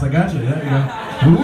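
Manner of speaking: usual speaking voice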